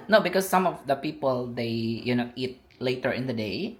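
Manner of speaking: ordinary delivery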